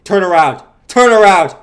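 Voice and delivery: loud, high preacher-style order; hammed-up cult leader barking orders; mock cult command.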